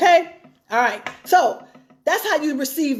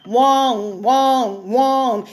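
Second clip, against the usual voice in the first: Charlie Brown droning; much slower than usual. Retold or imitated. Mimicking a nagging woman as the man hears her, mock droning. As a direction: imitate a droning, wordless "wong" noise. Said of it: "You start sounding like Charlie Brown".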